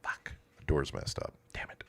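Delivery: whispered under the breath; annoyed whisper. Real, not acted. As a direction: mutter it to yourself in a whisper. Muttering at broken door.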